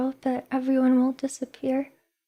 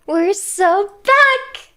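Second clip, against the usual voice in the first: higher, louder, stretching back out slowly; excited drawn-out cheer; 7 semitones higher, 5 dB louder, much slower than usual. Shown as a demonstration. Streamer celebrates her return to chat, slow, excited cheer. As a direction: sell the comeback like a hype cheer. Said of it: "first take plainer".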